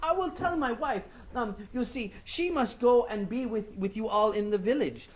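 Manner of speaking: high shocked voice. Acted. Anansi hearing the news.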